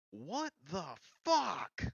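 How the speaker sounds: slow, high-pitched drawn-out yell. Acted. Game master voices Ember yelling from the road, slow high yell. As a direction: yell it in disbelief, dragging each word. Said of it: "all you hear him yell is".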